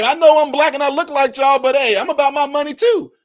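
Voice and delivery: high, loud mocking politician voice; mock mayor. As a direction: play the mayor bragging about money. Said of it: "he like".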